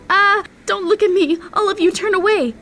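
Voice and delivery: high, loud, mortified cry; panicked order to the onlookers; distressed outcry. Acted. Girl exposed, wig has fallen off.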